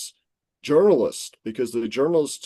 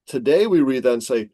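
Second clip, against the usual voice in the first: normal talking voice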